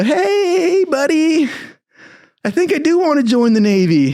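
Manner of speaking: high, cracking, begging voice; desperate pleading. Retold or imitated. Jailed guy begging recruiter on phone, high and pleading. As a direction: plead like a cornered kid. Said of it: "I go".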